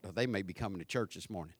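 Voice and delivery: hushed sudden realisation; quiet aside. Retold or imitated. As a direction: mutter it to yourself quickly. Thought voiced in pastor's head.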